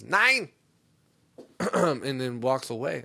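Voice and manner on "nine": mock German "nein", loud and high; high, forceful one-word refusal; German lady's shout. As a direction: bark it like an indignant German lady. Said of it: "German nineteen-year-old lady goes".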